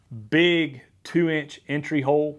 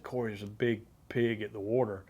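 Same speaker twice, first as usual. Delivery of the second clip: much softer, urgent hushed call to a partner; hushed alert; 4 semitones lower, 9 dB quieter than usual. Retold or imitated. Hunter quietly telling his guide about the pig, hushed. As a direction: tip off your buddy without spooking the pig.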